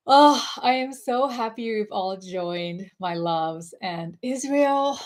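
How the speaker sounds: ordinary talk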